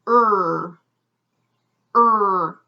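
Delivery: Er held as one long r-coloured vowel; isolated sound. Shown as a demonstration. Teacher giving the second syllable, isolated sound. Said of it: "is one sound".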